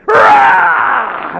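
imitating a demon-possessed woman's roar mid-story; demonic roar. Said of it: "she went".

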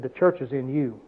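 low, soft divine whisper; quiet voice of God. Retold or imitated. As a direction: say it softly as God's voice. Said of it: "he put that finger right on my nose and he said".

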